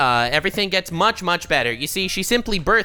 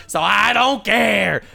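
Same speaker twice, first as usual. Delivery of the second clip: defiant shout; 4 semitones higher, 5 dB louder, slower than usual. Real, not acted. Defiant outburst about being lambasted.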